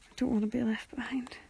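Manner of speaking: soft whispered aside; a hushed, playful whisper. Real, not acted. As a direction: whisper it, half to herself.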